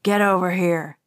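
low, rough command, lightly voiced; a hint of a growling hijacker; growled order. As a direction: growl it at the attendant. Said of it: "he growled at her".

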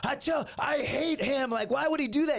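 mock furious complaint; retold exasperated girlfriend. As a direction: rant like a fed-up girlfriend. Girlfriend rants about cousin.

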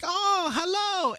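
very high, slow friendly dog greeting; high slow sing-song dog voice. Retold or imitated. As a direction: voice a big puppy saying hello, sweet and drawn out.

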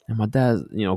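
ordinary talk

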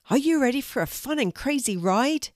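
quick, high call to the riders; calling over noise. A narrator's reading. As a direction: rouse them for a wild ride. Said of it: "he shouted over the noise".

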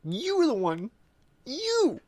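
high squeaky accusation, slow at the end; playful squeal. Real, not acted. Teasing friend about pooping, high and squeaky. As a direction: squeal it back at him.

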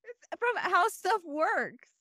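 high, tearful laughing; laugh-crying disbelief. Real, not acted. Asking co-hosts if the article is a joke, laughing through tears. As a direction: laugh so hard it nearly turns to crying.

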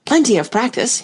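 insistent, lifted repeating; emphatic repeating. Acted. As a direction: press the advice eagerly. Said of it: "he went on repeating".

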